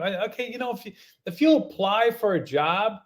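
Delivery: ordinary talk, nothing marked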